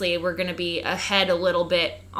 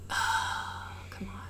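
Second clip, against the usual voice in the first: breathy, much softer groan of defeat; exasperated whisper; 11 dB quieter, much slower than usual. Real, not acted. Fed up with the dental company, whispered under her breath.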